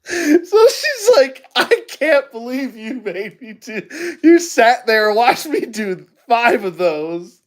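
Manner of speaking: laughing high and slow through the quote; crying with laughter as he quotes her; giggling high while mimicking her complaint. Real, not acted. Laughing, quoting waitress.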